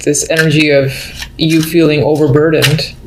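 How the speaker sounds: ordinary talk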